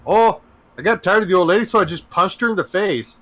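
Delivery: Canadian suspect shrugging off what he did; mock Canadian. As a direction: confess it breezily, eh. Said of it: "And he's like".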